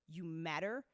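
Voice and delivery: normal talking voice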